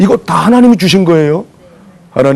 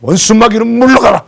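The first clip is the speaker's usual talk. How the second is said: higher, forceful command; commanding rebuke; 7 semitones higher than usual. Acted. Luther commanding the devil to leave, forceful. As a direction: cast the devil out.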